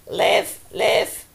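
short, low raven croak; raven's cry. A narrator's reading. Raven calling to Jon on his shoulder, short croaked cry. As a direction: cry it like a bird, cut short.

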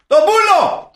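loud, high-pitched yell; blunt shouted dismissal. Acted. Fed-up host telling lingering party guests to clear off, shouting. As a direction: cut everyone off with one big shout.

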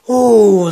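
sudden loud pained ooh; pained yelp. Real, not acted. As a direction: yelp from a hurt wrist. Slammed wrist on ground.

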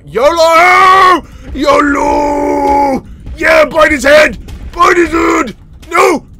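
loud, high charge cry; rally the troops into attack; battle shout. Real, not acted. Gamer shouting as his fish charge the catfish.

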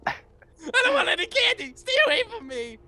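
high-pitched pretend frightened whine; mock panicked refusal. Player refusing a stranger's candy, mock panic. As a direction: refuse in a hammed-up, high little voice.

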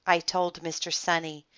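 ordinary delivery

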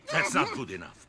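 ordinary delivery

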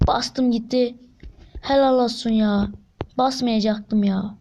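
normal talking voice

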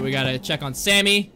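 normal talking voice

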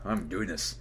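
hurting all over, weak; sore muscles. Real, not acted. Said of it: "Everything hurts right now".

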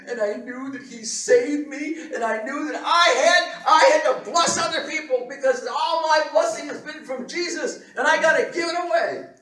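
loud, high, building to a shout; fervent, overflowing proclamation; impassioned testimony. Retold or imitated. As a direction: cry out a newfound conviction.

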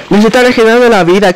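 usual speaking voice

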